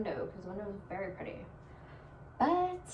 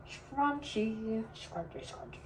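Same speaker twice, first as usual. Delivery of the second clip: fading repetition; 6 dB quieter, slower than usual. Pretend. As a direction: let "scrunchy" fade to nothing. Repeats word fading away.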